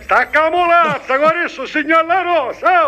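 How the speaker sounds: yelling down the phone as if on the site; shouting. Acted. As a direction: bellow it like across a building site. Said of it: "Sì, ma non urli".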